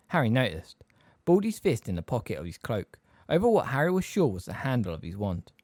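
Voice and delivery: ordinary delivery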